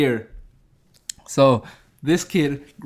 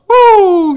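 loud, high siren whoop; imitated police siren; 13 dB louder than usual. Cops pull up behind the car, loud high siren imitation. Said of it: "You just hear the siren".

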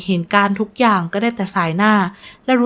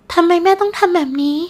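hurt, higher-pitched question; reproachful; 9 semitones higher than usual. A narrator's reading. Betrayed daughter questioning her stepmother, reproachful. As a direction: ask as if wounded. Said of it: "ดารารายมองหน้านางจันด้วยสายตาตัดพ้อ".

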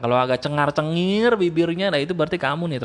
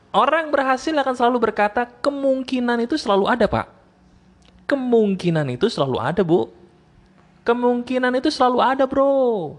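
upbeat optimist; 7 semitones higher, 3 dB louder than usual. Retold or imitated. Upbeat voice of a successful person.

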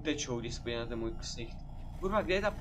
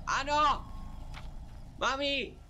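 triumphant yell; 11 semitones higher, much slower than usual. Real, not acted. Streamer gets the last page, triumphant yell. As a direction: shout out the win.

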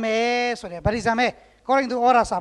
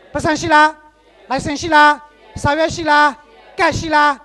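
loud, insistent rhetorical questions; loud questioning; 2 semitones higher, 5 dB louder than usual. Real, not acted. Preacher quizzing the congregation, loud and pressing. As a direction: press the crowd with each question.

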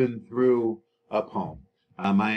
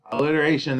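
ordinary talk, nothing marked

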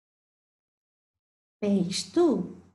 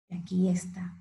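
whisper; 5 semitones lower, 3 dB quieter, faster than usual. Acted. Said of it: "suspense before reveal".

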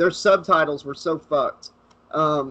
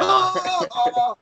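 very high, drawn-out "aah"; playful wordless wail, pitched way up; mock high cry; 14 semitones higher, slower than usual. Wordless cries after the mock jingle.

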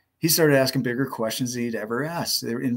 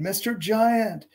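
high, drawn-out calling; renewed mock yell; 9 semitones higher, slower than usual. A narrator's reading. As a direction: stretch out a high call to a giant. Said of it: "Rit tried again".

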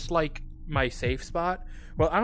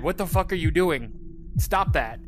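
ordinary delivery

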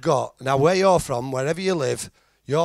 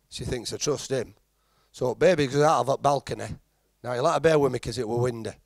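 usual speaking voice

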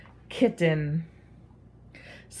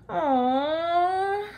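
high drawn-out disappointed aw; groan; 10 semitones higher than usual. Acted. Splat losing to Spike's bigger heart, high groan. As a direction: moan it, deflated. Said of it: "groaned Splat".

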